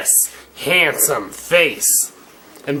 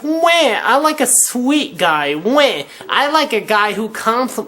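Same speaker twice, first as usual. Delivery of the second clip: higher, slow whiny mocking voice; whiny mock woman; 7 semitones higher than usual. Mocking what women claim they want, in a high whiny voice. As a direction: whine it like a spoiled girl.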